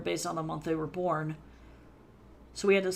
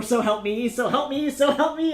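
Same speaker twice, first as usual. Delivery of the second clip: building mock threat; 6 semitones higher, 9 dB louder than usual.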